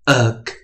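uk as short u closed by a k; phonics demo. Shown as a demonstration. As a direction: say uk three times, short u then crisp k. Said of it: "那所以U C K 我们就念".

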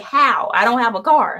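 exasperated. Retold or imitated. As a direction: throw up your hands in protest. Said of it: "I'm like".

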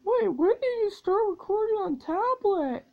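high, startled question; surprised high question. Real, not acted. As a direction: squeak it in disbelief. Other kid asks, high pitched.

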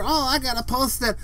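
high, drawn-out mock whine; mocking complainers. Imitating negative posters on social media, mock whine. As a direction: draw it out in a high mocking moan. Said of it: "They all say".

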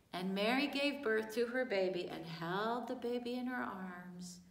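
usual speaking voice

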